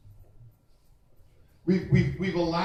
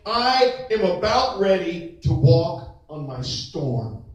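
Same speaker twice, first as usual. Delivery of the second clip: slow, louder, every word weighted; declaration; 6 dB louder, slower than usual. Shown as a demonstration. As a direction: declare it slowly as your own vow. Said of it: "I want you to stop and think".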